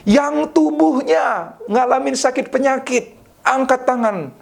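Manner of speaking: high, drawn-out preacher's summons; altar call. Retold or imitated. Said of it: "Altar call pertama".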